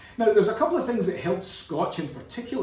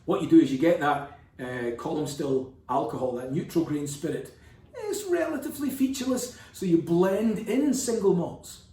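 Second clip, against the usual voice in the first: ordinary talk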